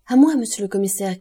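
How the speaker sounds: cri du brigadier. A narrator's reading. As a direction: call out to the commissaire for help. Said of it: "cria le brigadier". (appel lancé d'une voix forte)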